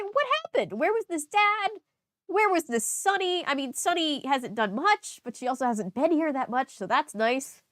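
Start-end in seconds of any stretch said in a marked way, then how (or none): 0.0-3.4 high, startled what-happened questions; asking in pitched-up disbelief; surprised questions. Real, not acted. Reader reacting to the diary, surprised and high.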